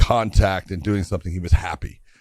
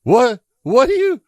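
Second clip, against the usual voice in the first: high startled yelp, louder; startled shout; 12 semitones higher, 7 dB louder than usual. Retold or imitated. Scott startled by the boy mid-cut, high loud yelp. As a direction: jump in alarm at the kid. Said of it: "and he goes".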